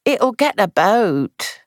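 dismal. A narrator's reading. Maid fearing gossip, slow and dismal. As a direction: let the words sink heavily. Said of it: "said Edna dismally".

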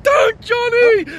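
high, loud cry of warning; retold scream. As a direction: scream the name as if it's happening now. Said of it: "The girl shouted".

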